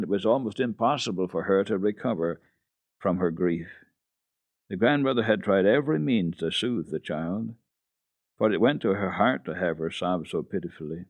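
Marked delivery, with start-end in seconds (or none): none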